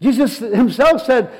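normal talking voice

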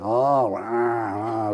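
low, drawn-out slowed-down roar; slow-motion groan. Retold or imitated. Telling how it seemed like slow motion, low drawn-out groan. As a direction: imitate sound in slow motion, low and soft. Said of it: "slow, slow motion".

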